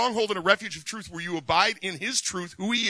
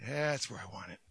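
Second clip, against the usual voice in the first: much softer, lower, calming voice; soft soothing words fading to near silence; gentle hush; 4 semitones lower, 10 dB quieter than usual. Retold or imitated. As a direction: settle someone down tenderly. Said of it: "God says".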